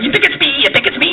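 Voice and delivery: high-pitched, quick indignant wise guy; Joe Pesci. Acted. Pesci as a disciple protesting, high-pitched impression. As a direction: pipe up high and defensive. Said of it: "Joe Pesci going".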